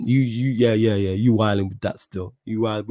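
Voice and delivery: ordinary delivery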